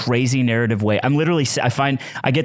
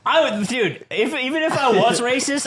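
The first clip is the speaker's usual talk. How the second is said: near screaming; 9 semitones higher than usual. Real, not acted. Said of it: "You sound like you're just screaming".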